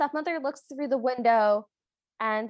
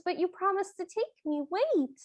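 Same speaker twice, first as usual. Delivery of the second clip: higher, pleading call after them; 7 semitones higher than usual. Retold or imitated. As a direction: plead as the carriage leaves. Cinderella calling after carriage.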